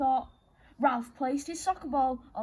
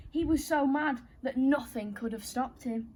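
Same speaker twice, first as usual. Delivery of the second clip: plain reading voice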